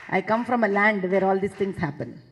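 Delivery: ordinary delivery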